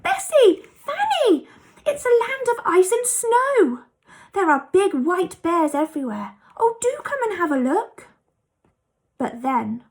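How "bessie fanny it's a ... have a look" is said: much higher, louder thrilled calling; excited shout. A narrator's reading. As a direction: shout down with delight at the snow. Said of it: "He gave a shout".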